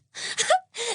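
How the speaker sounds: high squealing burst of laughter; helpless laugh. Acted. Maud helpless with laughter on her broom, squealing laugh. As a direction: laugh till you cry. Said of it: "Maud was now laughing so much".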